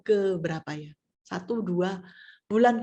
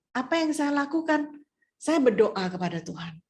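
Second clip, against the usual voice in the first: ordinary talk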